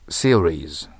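"series" with a clear English r, not the Japanese リ. Shown as a demonstration. Teacher showing students how to say series with an English r. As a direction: say "series" with a curled r sound. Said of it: "seriesと発音します".

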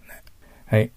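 ordinary talk

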